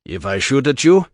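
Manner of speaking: put-on thick Russian accent, cold and blunt; Russian accent, dry threat. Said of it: "said in his Russian accent".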